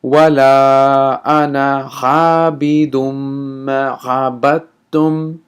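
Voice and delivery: slow, chanted Quran recitation; teacher reciting the verse for students. Shown as a demonstration. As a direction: recite it slowly so students can follow. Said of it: "let me say this verse one more time".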